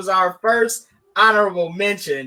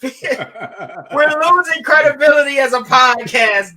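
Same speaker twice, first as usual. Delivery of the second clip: higher-pitched, laughing exclamation; laughing outcry; 5 semitones higher than usual. Real, not acted. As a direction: call it out through real laughter. Cracks up over chat room reaction.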